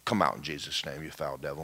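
low, hushed casting-out; gentle, unforced command. Retold or imitated. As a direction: order it out softly, almost in passing. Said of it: "smiled, and just said".

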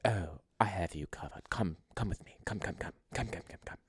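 hushed, whispery mock British lady; imitating a British granny, very quiet and breathy; old British woman's accent. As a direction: usher someone along in a soft old British woman's voice. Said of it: "an old British woman's accent".

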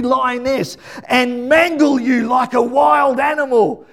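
high, loud, impassioned preaching; full-force preaching. Real, not acted. Preacher telling mums they mirror God's fierce protectiveness, shouted preaching.

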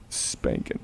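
put-on a crying toddler's tiny answer; small tearful voice. Daughter answers her father in a soft tearful whisper.

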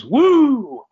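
high, loud held Flair woo; Ric Flair woo. Retold or imitated. Ric Flair greeting God with his woo. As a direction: let out the signature woo. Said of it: "And Rick goes".

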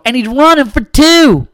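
mock Texan fan topping the last guy; Texan accent. [name] joining the Texan bit, hammed-up Texan accent. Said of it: "Bill and I were doing accents".

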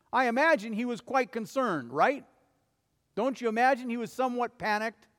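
ordinary talk

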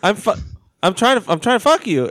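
high, halting retold disbelief; mock bewildered protest. John to the woman walking out, bewildered. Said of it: "and he's like".

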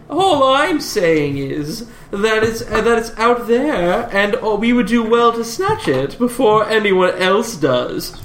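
high, slow drawn-out character voice; theatrical, leisurely lilt; Hugo. Acted. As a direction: stretch the words out as you argue your case. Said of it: "you overhear Hugo".

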